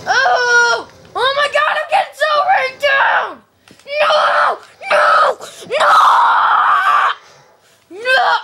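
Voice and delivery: loud, high-pitched cries of protest; frantic shouting. Real, not acted. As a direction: wail out each drawn-out no in panic. Losing it as he dies in game.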